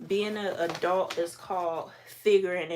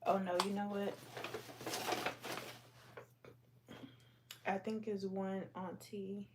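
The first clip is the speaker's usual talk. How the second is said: muttering to herself; 12 dB quieter than usual. Real, not acted. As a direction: mumble it under your breath. Muttering to herself while sorting.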